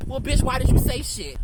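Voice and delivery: loud mocking sneer; loud catty mock question; mock gossip. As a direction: yell it like a catty onlooker.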